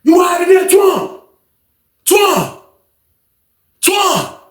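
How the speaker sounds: calling out. Retold or imitated. Roommate calls for Twan through the door, anxious. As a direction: shout a name through the door. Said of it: "his one roommate was like".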